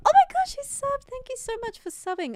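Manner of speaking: very high, gushing thanks; excited squeal. Real, not acted. Streamer thanking a new subscriber, in an excited high squeal. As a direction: squeal with delight, starting loud then easing.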